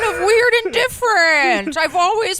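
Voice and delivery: high, loud, squawky oddball lady. Retold or imitated. Impersonating [name] to his cohost, high and loud. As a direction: squawk it out like a parakeet diva. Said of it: "combination of [name], [name], and a parakeet".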